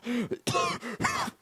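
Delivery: high, thin mock wheeze; imitated wheezing cough. Martin wheezing, mimicked in a high, thin cough. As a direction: wheeze like a man without his inhaler. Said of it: "Martin is over there going".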